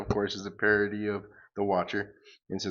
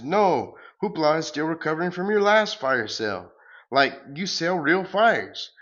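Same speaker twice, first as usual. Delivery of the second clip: a lightly voiced grandiose superhero, louder; pompous hero; 7 semitones higher, 7 dB louder than usual. The hero boasting as he flees, lightly pompous hero voice. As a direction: declaim like a self-important comic hero.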